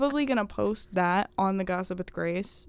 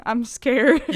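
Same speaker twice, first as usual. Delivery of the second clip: slow, laughing fake fright; laughing mock fear; 3 semitones higher, 7 dB louder than usual. Friend laughs at the mock threat, pretending to be scared. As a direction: pretend to be scared through giggles.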